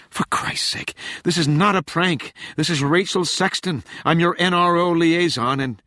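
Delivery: high, loud, desperate woman; a frantic agent pleading urgently; frantic young woman. A narrator's reading. Rachel voiced, frantic.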